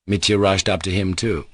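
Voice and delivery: ordinary delivery, nothing marked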